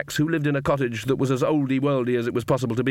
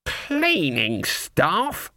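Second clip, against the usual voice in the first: indignant disbelief; 5 semitones higher, much slower than usual. Acted. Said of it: "said Granny".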